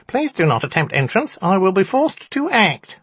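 high, squeaky little imp; squeaky nasal voice. A narrator's reading. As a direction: squeak it out through the nose. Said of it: "it squeaked again".

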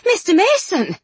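high, startled exclamation; surprised recognition. A narrator's reading. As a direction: gasp out his name. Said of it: "then gasped".

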